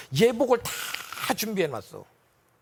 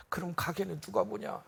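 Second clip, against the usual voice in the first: dying man's punchline; 8 dB quieter than usual. Retold or imitated.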